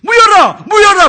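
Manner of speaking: high, loud shout of a name; calling out. Retold or imitated. Teacher calling out to him. (이름을 높고 크게 외치며)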